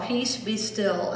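slow soft reading. Shown as a demonstration. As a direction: read the sign slowly. Reading sign again.

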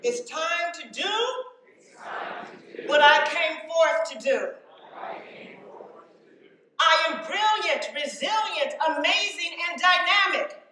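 building to loud affirmations; booming motivational speech. Acted. Affirmations rising to shouting.

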